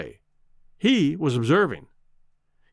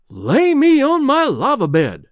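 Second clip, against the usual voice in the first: higher, louder and slow, eyes bulging; aghast exclamation; 9 semitones higher, 6 dB louder than usual. Acted. Cowboy aghast at the fancy menu. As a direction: gasp out the line in shocked disbelief. Shocked at the bill of fare.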